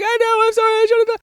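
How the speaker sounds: squeaky mock sobbing apology; crying kid. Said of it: "The kid was crying".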